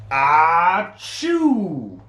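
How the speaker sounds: loud sneeze in a changed voice; loud fake sneeze. Bear sneezes.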